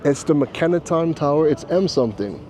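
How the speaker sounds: usual speaking voice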